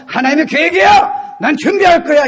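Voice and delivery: high, punchy shouted declaration; defiant faith declaration. Shown as a demonstration. Preacher modeling words of faith after failure, defiant shout. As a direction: declare it boldly, like a battle cry. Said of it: "이렇게 얘기를 하셔야죠".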